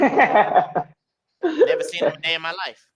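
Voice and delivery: hammed-up loud protest; mock denial. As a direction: blurt it out fast like a panicked denial. Retelling denial to officials.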